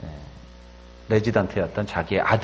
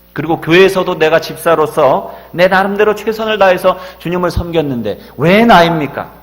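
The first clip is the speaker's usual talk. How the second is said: loud, higher, desperate prayer to God; crying out in prayer; 4 semitones higher, 7 dB louder than usual. Retold or imitated. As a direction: pour it out as a desperate cry to heaven. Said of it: "부르짖어 기도합니다". (하나님께 절규하듯 높고 크게 부르짖는 기도)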